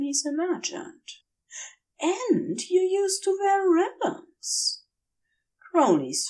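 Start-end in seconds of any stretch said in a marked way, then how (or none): 2.0-4.8 dreamy angel. Acted. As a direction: gush a little, half-drunk. Woozy angel remembers.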